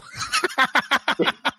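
high-pitched giggle; helpless high laugh; laughter. Real, not acted. Laughing at own joke.

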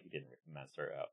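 low, very soft scoff; sceptical undertone. Retold or imitated. Townsfolk doubting the Roadhouse rumour, sceptical undertone. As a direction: play a doubter dismissing the rumour quietly.

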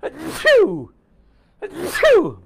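two big real sneezes; loud sneeze. Real, not acted. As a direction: sneeze loudly twice. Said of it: "I think I have to sneeze".

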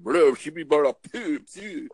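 high, loud fake-French gibberish; mock-furious French babble at high pitch; mock French yelling. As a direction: imitate a sputtering, screaming French rant. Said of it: "he was screaming and yelling and spitting in French".

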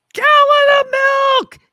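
a huge, high, slow shout of the punchline; kids shouting. Retold or imitated. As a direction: yell it out like a room of kids. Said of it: "the kids are like".

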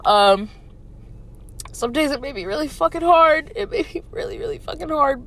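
holding back tears mid-thought; getting emotional. Real, not acted. Podcaster fighting tears while reflecting on ten years. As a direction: voice rising and catching, close to tears. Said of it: "maybe I am getting emotional".

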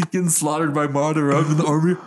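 high-pitched laugh-cry through the words; cracking up while picturing a grim fate; giggling through it. Real, not acted. Laughing at dying in Naruto.

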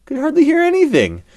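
high, fast, blurred voices; muffled voices. A narrator's reading. Lion hears only muffled voices, high and fast. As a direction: garble it as if through stuffed ears.

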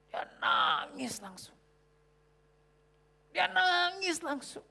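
high, slow, breaking narration; slow, moved retelling of his crying; high trembling retelling; emotional. Retold or imitated.

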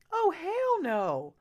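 slow, loud and high, drawn out in disbelief; angry outburst. Real, not acted. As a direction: let the outrage stretch each word. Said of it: "I'm mad".

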